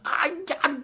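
high, restrained "aiya"; acted cry of pain. A narrator's reading. Storyteller voices Wu as he is stabbed, high mock yelp. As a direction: yelp as if stabbed, without extra volume. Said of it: "一声".

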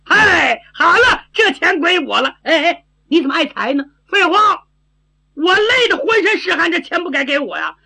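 put-on high-pitched silly girl claiming her due; brash simple-minded girl, grabby. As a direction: pipe up high and stubborn, like a foolish tomboy. (傻丫头高声耍赖)

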